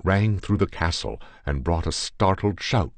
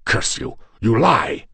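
loud, higher furious shout; 7 semitones higher, 6 dB louder than usual. A narrator's reading. Demart to the narrator, shouting in rage. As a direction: roar the denial before drawing. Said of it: "he shouted".

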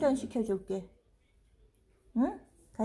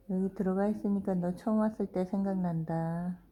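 ordinary delivery

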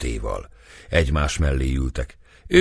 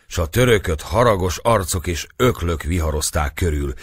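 usual speaking voice

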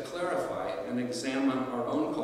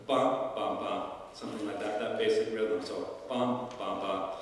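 bum bum bum, long then two shorts; dactyl rhythm; 2 semitones lower than usual. Shown as a demonstration. Teacher demonstrating the dactyl beat to students, rhythmic. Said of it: "So a dactyl is".

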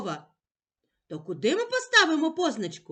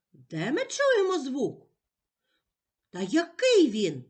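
ordinary talk

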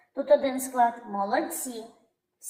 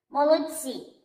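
ordinary talk

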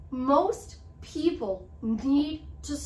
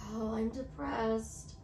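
slow and much softer, sinking down; depressed voice; 3 semitones lower, 7 dB quieter, slower than usual. Shown as a demonstration. Showing viewers how a depressed answer sounds, slow and soft. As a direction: let the voice drop as if defeated. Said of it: "your voice gets lowered".

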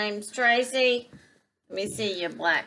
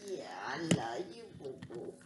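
tender whisper to a dog; 11 semitones lower, 8 dB quieter than usual. Real, not acted. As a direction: breathe it softly to the puppy. Cooing to the dog.

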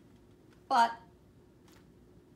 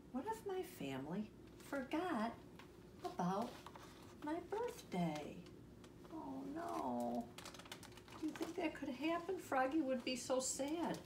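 high, soft, halting fret; worried wondering; 8 semitones higher, 6 dB quieter than usual. A narrator's reading. Reader voices Froggy fearing a forgotten birthday, soft worried tone. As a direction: fret softly up high, with pauses.

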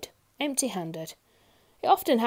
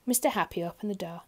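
ordinary delivery, nothing marked

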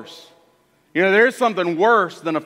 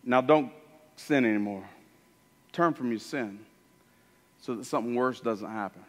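much softer, lower, tender voice; quietly and kindly tell him to sin no more; soft fatherly caution; gentle warning; 8 semitones lower, 8 dB quieter than usual. Acted. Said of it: "Jesus says".

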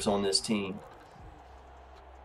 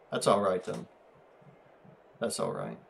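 ordinary talk

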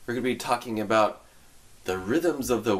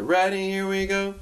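cheery cue; 7 semitones higher than usual. Real, not acted. Cueing class to start.

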